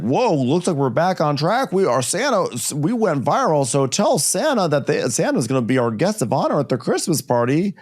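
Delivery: high-pitched mock excited dad. Dad telling everyone Santa will be guest of honor, excited. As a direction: gush like a dad whose luck just turned. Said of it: "the dad's excited".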